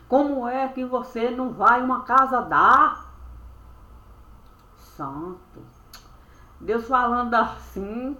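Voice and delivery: usual speaking voice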